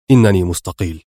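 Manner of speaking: low, stern declaration. A narrator's reading. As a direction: announce it with controlled finality. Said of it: "صوت أدهم الصارم".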